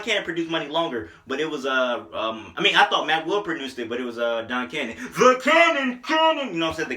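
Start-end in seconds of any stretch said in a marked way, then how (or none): 5.0-6.5 high, slow hyped tag drop; drawn-out shout like a beat intro; producer tag. Retold or imitated.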